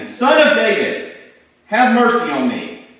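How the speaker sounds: high, loud, desperate shout; loud cry. A narrator's reading. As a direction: cry out to be heard and pitied. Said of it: "And I cried out again".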